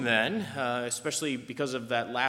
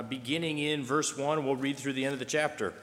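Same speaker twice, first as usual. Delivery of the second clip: ordinary talk, nothing marked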